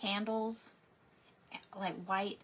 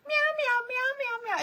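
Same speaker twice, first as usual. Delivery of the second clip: very high, louder run of meows; string of meows; 7 dB louder than usual. Retold or imitated. Girl meowing nonstop at the worried caller. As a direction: meow on and on, pleading like a cat. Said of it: "she was just meowing".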